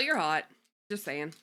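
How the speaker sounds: ordinary delivery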